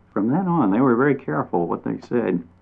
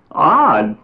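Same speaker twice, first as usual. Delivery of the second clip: high, louder indignant cry; 7 semitones higher, 6 dB louder than usual. A narrator's reading. Mockingbird crying out at the chipmunk's word, indignant. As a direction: fling the word back. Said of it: "cried the mockingbird".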